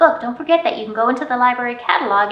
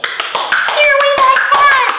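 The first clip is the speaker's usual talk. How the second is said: very high, loud squeal; squeaky high mouse; 7 dB louder, slower than usual. Acted. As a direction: shriek it to scare the cat. Climax of mouse song.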